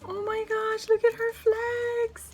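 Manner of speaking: high squeals of delight, voice cracking; overjoyed high-pitched gushing; excited squealing. Real, not acted. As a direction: squeal over every gift until your voice gives out. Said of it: "I'm losing my voice".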